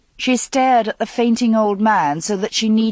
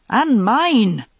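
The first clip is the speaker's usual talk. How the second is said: forceful claim; 5 semitones higher, 5 dB louder than usual. A narrator's reading. Knights demanding vengeance.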